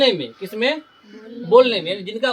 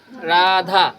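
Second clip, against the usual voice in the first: Radha with both vowels stretched; extra-long vowels; 3 semitones lower, much slower than usual. Shown as a demonstration. Teacher giving an example of prolonged vowels, Radha drawn out. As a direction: draw Radha out slowly, holding each आ. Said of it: "दुगुना मतलब दीर्घ समय लगता है".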